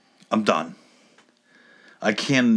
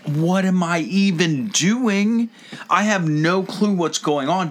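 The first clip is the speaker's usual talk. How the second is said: higher, drawn-out baffled complaint; bewildered protest; 6 semitones higher than usual. Retold or imitated. Confused customer retelling his reaction at the oil change, bewildered. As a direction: stretch out the exasperated disbelief. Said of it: "And I was like".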